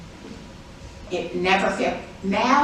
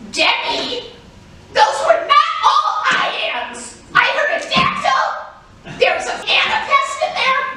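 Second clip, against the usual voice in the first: high, loud and slow mock-indignant shout; mock upset poet; 8 dB louder than usual. Poets scolding Debbie, a hammed-up high indignant shout. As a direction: squeal like a pedantic poet. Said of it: "poets get upset and say".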